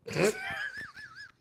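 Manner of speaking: very high squealing laugh; helpless laughter. Real, not acted. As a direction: squeal with laughter, trailing away. Cracking up at chat.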